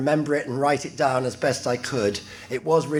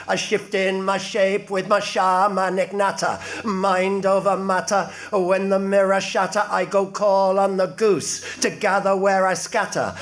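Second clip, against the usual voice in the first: incantatory, strongly rhythmic verse in a raised pitch; performed poem; 4 semitones higher than usual. Acted. John Crow the wicked shaman introducing himself, performed poem. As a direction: declaim it as the trickster shaman's chant.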